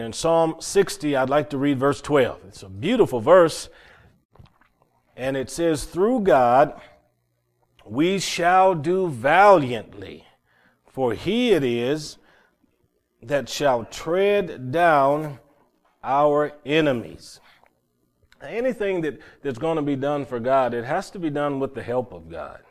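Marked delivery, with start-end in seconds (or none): 5.8-17.4 scripture reading. Acted. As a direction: declaim the verse slowly, every phrase weighted. Reads scripture verse aloud.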